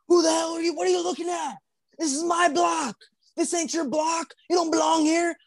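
high, confrontational mock yell; imitated screaming. Stranger claiming his block from across the street, mock screaming. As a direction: imitate a man challenging a stranger on his turf. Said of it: "He's just screaming".

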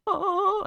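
high, loud mock "oh"; imitated groan. Picturing Jeff Daniels on the toilet, mock straining groan. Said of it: "picture him the whole time going".

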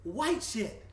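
slow and loud, drawn out like a hype man; hyped announcement. Acted. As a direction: stretch each word for the crowd. Hyping the segment topic.

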